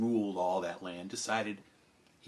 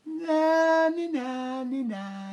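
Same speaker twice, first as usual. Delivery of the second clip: taunting na na; 4 dB louder, slower than usual. Retold or imitated. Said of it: "he'd go like".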